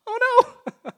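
high, loud squeal of a panicking sheep; hammed-up alarmed bleat; mock panic. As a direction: play it like a frightened sheep crying out. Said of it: "And we start going".

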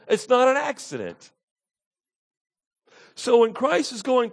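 high, impassioned sermon voice with loud peaks; full-force preaching. Acted. Preacher assuring congregation salvation is intentional, full-force preaching.

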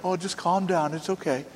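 soft mock calming; quiet, gently placating; soothing. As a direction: hush someone about to swing. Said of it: "and you're like".

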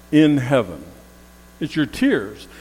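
usual speaking voice

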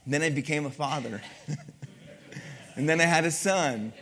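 ordinary delivery

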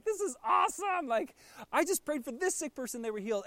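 high, excited gushing; breathless bragging to friends; excited disciple. Retold or imitated. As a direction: gush like a thrilled kid after a big win. Disciples swapping excited ministry stories.

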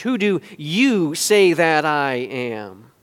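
YOU stretched out in a slow question; slow, probing question landing on YOU; stress on YOU. Shown as a demonstration. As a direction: draw out YOU, pointing the question at them. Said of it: "accurately reflects the emphasis".

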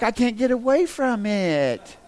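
mock exasperation. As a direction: stretch out a hammed-up wail of being trapped. Exasperated retold reaction to gift phone.

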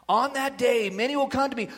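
ordinary delivery

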